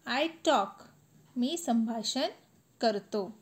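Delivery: normal talking voice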